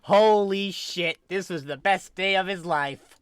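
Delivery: delighted. Acted. Sunny thrilled by the shattered controller, delighted. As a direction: revel in the wreckage.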